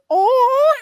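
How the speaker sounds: very high, loud imitation of grandma squealing; delighted squeal. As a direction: mimic an excited fan's "oh". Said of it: "she goes".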